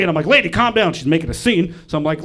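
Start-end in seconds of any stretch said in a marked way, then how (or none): 0.3-0.9 raised-pitch plea to settle down; mock exasperation with an old lady; acted attempt to calm someone; exasperated retort. Acted retort to ranting woman.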